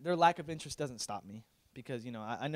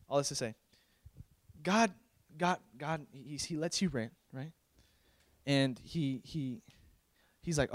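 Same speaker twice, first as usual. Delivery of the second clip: ordinary talk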